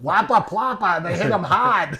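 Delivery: broad Bay State accent, "hard" as "hahd"; Massachusetts accent. Shown as a demonstration. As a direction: playfully show off a Massachusetts accent. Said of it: "We like your accent".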